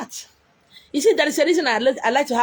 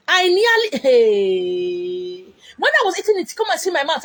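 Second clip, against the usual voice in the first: excited recall; 4 semitones higher, 3 dB louder than usual. Retold or imitated. Recalling eating octopus.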